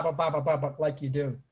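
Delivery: normal talking voice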